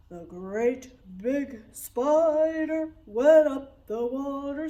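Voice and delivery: big spider voice, loud and slow; great big deep voice. Shown as a demonstration. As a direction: sing it as a giant spider, slow and booming. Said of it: "with a great big deep voice".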